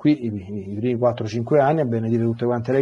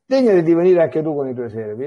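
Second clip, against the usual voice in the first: higher, louder pleading invitation; gentle plea; 5 semitones higher, 5 dB louder than usual. Acted. A disciple urging Elisha to join them, pleading. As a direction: ask him earnestly to come along.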